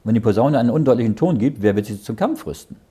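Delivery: usual speaking voice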